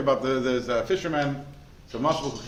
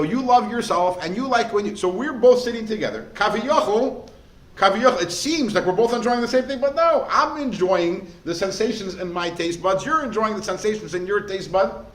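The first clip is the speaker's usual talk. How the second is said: louder and higher, a fervent sermon push; preaching at full force; 7 semitones higher, 6 dB louder than usual. Acted. Rabbi preaching to his listener, loud and fervent. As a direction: drive the point home to the crowd.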